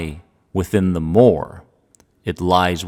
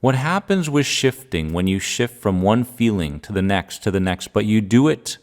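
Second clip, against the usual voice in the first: ordinary delivery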